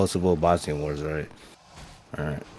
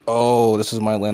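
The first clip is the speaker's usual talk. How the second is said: lightly mocking, raised-voice imitation; mock yapping; 4 semitones higher, 6 dB louder than usual. Caller mocking Palestinians yapping about their land, mildly mocking yap. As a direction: mimic a yapper's complaint, a bit higher and louder. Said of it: "they be yapping about some".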